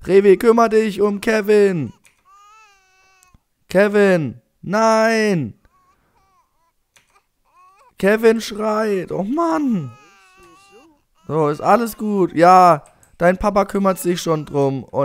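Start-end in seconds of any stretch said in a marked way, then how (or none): 0.0-9.9 high, whiny calling out; exasperated pleading. Pretend. Player begs game father over crying baby, exasperated. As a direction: wail in mock despair.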